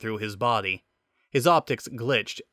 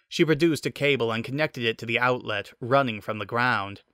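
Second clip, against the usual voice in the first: plain reading voice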